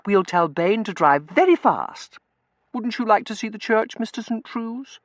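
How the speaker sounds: very high, loud wheedle; high-pitched, insistent protest; girlish pleading. A narrator's reading. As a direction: beg your cousin like a spoilt girl.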